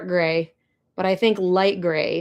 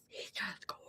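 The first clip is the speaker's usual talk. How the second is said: barely audible, much softer whisper; faint whisper; 22 dB quieter than usual. Real, not acted. To the stream, repeating her awe, fading whisper. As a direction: let it fade to almost nothing. Swooning over the stallion.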